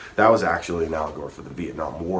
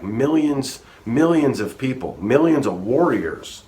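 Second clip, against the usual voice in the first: emphatic build; 7 semitones higher, 6 dB louder than usual. Real, not acted. Stressing the Aztec numbers.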